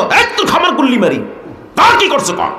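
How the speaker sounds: high, fast, impatient scolding; mock angry outburst. Caliph rebukes the evasive uncle, acted angry outburst. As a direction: cut him off with a high-pitched rebuke.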